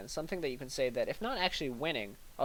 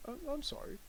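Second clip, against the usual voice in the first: dismissive apology; 13 semitones higher, 8 dB quieter than usual. Shown as a demonstration. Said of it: "You're saying".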